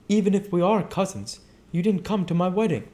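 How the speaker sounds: Daisy confiding in her cousin Nick; Daisy speaking. Acted. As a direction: speak suddenly and searchingly.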